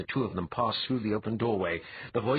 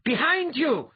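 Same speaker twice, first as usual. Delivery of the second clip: loud, high-pitched yell of a name; shouted warning; 6 dB louder, slower than usual. A narrator's reading. Althalas warns his friend of an attacker, shouting. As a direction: yell it out urgently to save him. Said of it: "Althalas shouted".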